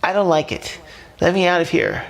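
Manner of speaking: high, growing protest; rising anger. A narrator's reading. Fish demanding the octopus let him out, getting angry. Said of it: "with rising anger".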